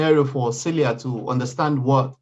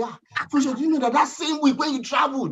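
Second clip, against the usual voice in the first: higher, lightly voiced meddling caller; pitched-up insinuating tone; gossiping caller; 10 semitones higher than usual. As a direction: imitate a nosy friend stirring doubt. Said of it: "someone now calls me and say".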